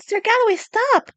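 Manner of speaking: high, urgent call. A narrator's reading. Desmond tries to stop the knight, high shout. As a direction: yell at him to stop.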